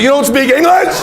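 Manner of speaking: triumphant taunt. Retold or imitated. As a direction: catch him out, crowing. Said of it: "and I say".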